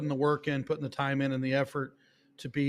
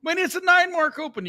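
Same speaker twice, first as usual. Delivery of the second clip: much higher, louder burst of amazement; excited; 14 semitones higher, 5 dB louder than usual. Real, not acted. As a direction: blurt it out, impressed. Impressed by a nine mark opening.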